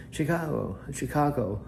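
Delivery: a playful high Chicago said twice; imitating Chicago. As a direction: call Chicago like the US city, teasing. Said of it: "I called her Chicago".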